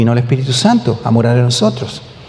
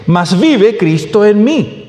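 "Mas vive Cristo en mí" slow and raised, in one run; emphatic verse repeat; 5 semitones higher, much slower than usual. Real, not acted. Repeats verse after calling it clear.

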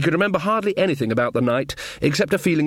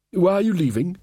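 higher, mildly anxious question; nervous; 6 semitones higher than usual. Acted. King asking Granny as she heads out, nervous. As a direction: ask it with a touch of unease. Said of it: "said Verence nervously".

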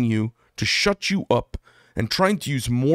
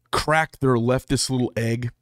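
ordinary talk

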